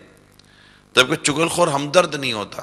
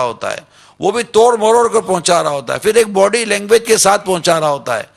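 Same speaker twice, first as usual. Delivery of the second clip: higher and louder, pressing sermon delivery; fervent preaching; 5 semitones higher, 4 dB louder than usual. Acted. Preacher urging brothers to call the backbiter, fervent preaching. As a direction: preach it out to the whole hall. Preacher builds to full force.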